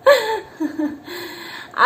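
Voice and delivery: squealing happy laugh; high delighted laugh. Real, not acted. Mother laughing with her baby.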